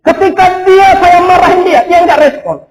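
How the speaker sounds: loud, high, driving sermon voice; fervent preaching. Acted. Pastor urging the congregation, loud fervent preaching. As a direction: preach it out to the whole church.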